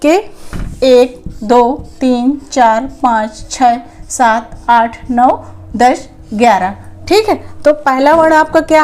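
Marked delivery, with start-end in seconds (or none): 0.8-6.7 one by one, drawn out for the class; slow counting. Shown as a demonstration. Teacher counting slowly for her pupils. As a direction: count out each number very slowly. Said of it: "तो जैसे आप देख लीजिए".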